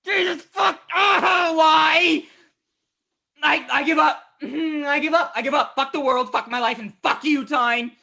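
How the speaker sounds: high, loud cries of despair; ranting at full pitch, beaten; anguished shouting. Acted. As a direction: shout it out in agony and give up. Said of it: "Why are you shouting?".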